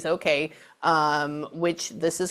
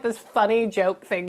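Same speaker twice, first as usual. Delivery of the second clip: normal talking voice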